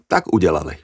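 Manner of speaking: usual speaking voice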